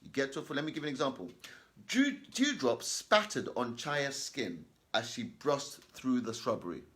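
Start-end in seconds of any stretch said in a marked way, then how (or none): 1.9-6.8 slow, deliberate reading aloud; careful classroom read-aloud; slow, loud and clear reading. Shown as a demonstration. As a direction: read each word slowly and clearly. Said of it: "count two seconds in your head".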